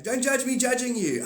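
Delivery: high, loud whiny mock protest. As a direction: whine it back in a high voice. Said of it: "You're like".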